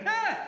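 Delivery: sharp high scream, cut short; loud, high burst of mock fright; startled yelp. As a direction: yelp as if a spider leapt at you. Spider jumps at him.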